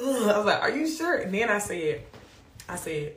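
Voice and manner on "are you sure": high-pitched disbelieving question; mock-incredulous, high and rising. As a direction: squeak up high in acted disbelief. Repeats the doubt to God, incredulous.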